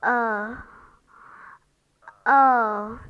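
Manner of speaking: long drawn-out uh, uh; long er vowel. Shown as a demonstration. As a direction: hold the er sound long. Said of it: "it's quite long, as you can hear".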